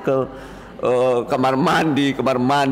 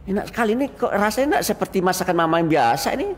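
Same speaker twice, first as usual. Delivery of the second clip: smiling praise; 8 semitones higher, faster than usual. Retold or imitated. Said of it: "kamu tersenyum".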